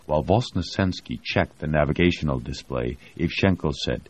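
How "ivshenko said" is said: ordinary delivery, nothing marked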